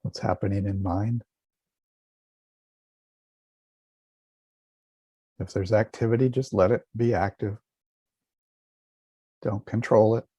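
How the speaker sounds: calm, measured meditation voice with long pauses; guided meditation. Acted. Teacher guiding listeners to relax and feel a smile, calm meditation voice. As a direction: guide listeners gently inward.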